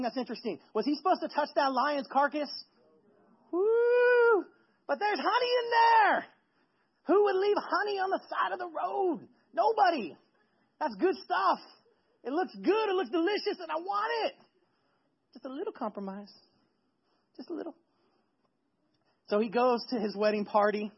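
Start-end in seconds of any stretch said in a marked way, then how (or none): 3.5-14.3 high, excited gushing over a treat; craving, tempted. Acted. Man tempted by honey in the lion's carcass, gushing high. 15.3-17.7 small, hushed self-justifying voice; coaxing excuse. Acted. Tempted man excusing a little compromise, softly coaxing.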